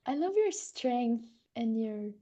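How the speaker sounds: soft, slow and hushed, trailing into near whisper; tender thanks. Real, not acted. Host welcoming a group member, tender and hushed. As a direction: thank them softly, taking your time.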